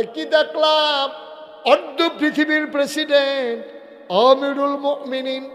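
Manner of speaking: high, loud, ringing proclamation; full-force preaching. Acted. Preacher tells of the moon's amazement, at full force. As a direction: proclaim it in awe to the crowd.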